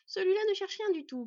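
loud, high, forceful objection; dismissive retort. A narrator's reading. She-wolf defends the boy, dismissive. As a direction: sweep the accusation aside loudly. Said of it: "balaya la louve".

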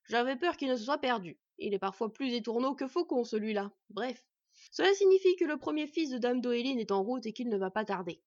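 loud, jovial craftsman voice; hearty, pleased smith; relieved dwarf smith. Acted. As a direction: share the good news heartily.

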